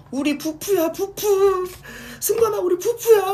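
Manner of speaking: very high, loud, tearful squeal; shrieking adoring fan near tears. Retold or imitated. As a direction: gush over him like an overwhelmed fan. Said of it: "이러면서".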